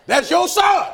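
high, loud mock insistence; loud exclamation. Preacher telling a denying father it's his baby, shouting. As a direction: belt it out high as the punchline. Acted retort about the baby.

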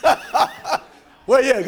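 laughing, pleading "where you at?"; half-joking cry to the Lord; amused mock lament; mock plea with a laugh. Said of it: "Joshua fell on his face and said".

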